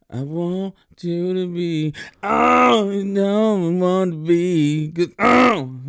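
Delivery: high, slow, lurching mock vocal; mocking a singer. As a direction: parody the singer's erratic outbursts. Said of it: "Tourette's on some parts".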